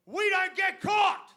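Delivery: high, slow shouted proclamation; mock family motto. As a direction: bellow the family rule with pride.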